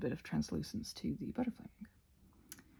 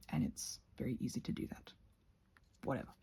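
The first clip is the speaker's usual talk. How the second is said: sheepish whispered aside; 7 semitones lower, 12 dB quieter, faster than usual. Real, not acted. Crafter whispering an aside to her viewers. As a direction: drop to a whisper to confess.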